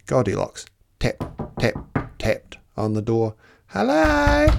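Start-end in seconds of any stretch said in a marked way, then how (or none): 3.7-4.5 high, loud call of hello. Acted. Goldilocks calls into the cottage, high and loud. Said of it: "she called".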